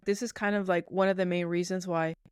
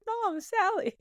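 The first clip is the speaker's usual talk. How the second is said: imitated yell; 14 semitones higher, slower than usual. Listener teasing about trying to just yell, high mock call. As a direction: squeak out a pretend cry for help. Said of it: "you can to just yell".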